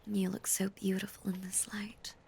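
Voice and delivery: usual speaking voice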